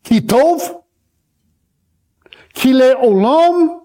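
"Ki tov, ki le olam" said slowly, syllable by syllable, in Hebrew; Hebrew phrase. Shown as a demonstration. Preacher teaching the church the Hebrew words, slow. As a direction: sound out "ki le olam" slowly, stressing "olam". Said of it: "what they were saying in Hebrew was this".

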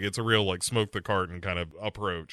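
usual speaking voice

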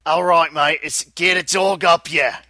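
mock Australian shout, slow; hammed-up Aussie yell; Australian accent. Asked to say something in Australian, a mock Aussie shout. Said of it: "Say it".

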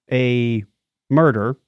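ordinary delivery

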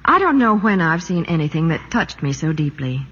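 ordinary delivery

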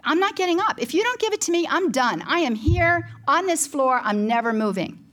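high, stubborn plea to God; retold screaming. Mother refusing to get up off the floor, retold screaming ultimatum. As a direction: dig in with a high-pitched ultimatum, dropping at the end. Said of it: "I am screaming this in the bedroom".

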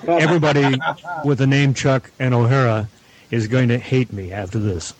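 ordinary talk, nothing marked

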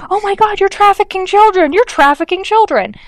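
hammed-up alarmed cry at the family; mock panicked accusation. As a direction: squeal it like a hysterical accuser. Imitating Lohan accusing the family.

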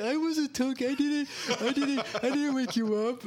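very high, tearful protest; hammed-up whiny denial; mock crying. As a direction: imitate a crying brother defending himself. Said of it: "And he's crying".

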